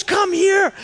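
high-pitched, imitated urgent call; insistent summons. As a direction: act out a friend demanding attention. Friend insists a second time.

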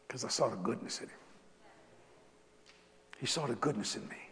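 hushed sincere repetition; soft refrain. Real, not acted. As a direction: drop to a quiet, heartfelt echo. Repeated refrain, softer.

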